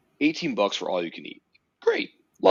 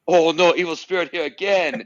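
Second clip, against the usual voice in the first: high, loud put-on Chinese accent on the mic; hammed-up spirit warning in a fake Chinese accent; mock Chinese accent; 8 semitones higher, 7 dB louder than usual. Announcing on the mic at the meet, mock Chinese accent. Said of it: "I say on the mic".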